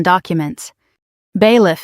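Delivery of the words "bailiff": ordinary delivery, nothing marked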